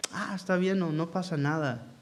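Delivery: a hint of a careless father, hushed; permissive father. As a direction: brush it off like an indulgent parent. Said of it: "Viene otra vez el padre permisivo".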